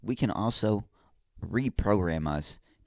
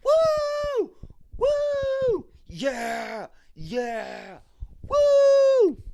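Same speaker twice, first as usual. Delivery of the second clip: much higher, louder, whooping shouts; mock winner's cheer; 8 dB louder, much slower than usual. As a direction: whoop like the guy who just won the car. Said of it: "He's like".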